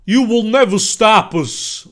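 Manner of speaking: slow, high, loud character voice; acted villain line. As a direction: declare it slowly and loudly, in character. Villain's line, before accent is chosen.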